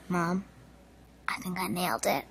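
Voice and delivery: very soft, secretive murmur; acted hushed confiding. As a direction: confide it quietly to mom. Confiding to mom after audition.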